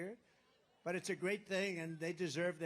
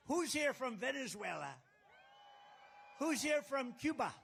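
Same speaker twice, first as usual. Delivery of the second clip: raised, high-pitched crowd shout; calling out; 6 semitones higher than usual. Real, not acted. Speaker asks the crowd where they're from, calling out. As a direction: call across a packed arena.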